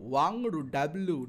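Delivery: slow ওয়াংড়ু with a clear w sound, then ডাব্লু; film pronunciation imitated. Teacher echoing how the film character says ওয়াংড়ু, slowly with a w sound. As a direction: draw out ওয়াংড়ু slowly, lips rounded for the w. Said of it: "এরকম করে বলছে".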